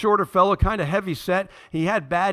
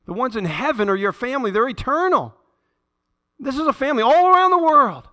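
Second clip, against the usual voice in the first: loud, high, full-force preaching; 10 semitones higher, 7 dB louder than usual. Acted. Pastor declaring the worldwide family to the church, loud and high.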